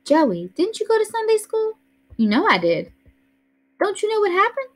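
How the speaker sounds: put-on high, worried little girl voice; a small girl pleading with her brother; little sister Joey. A narrator's reading. Little sister asks her strange brother what's wrong, high and worried.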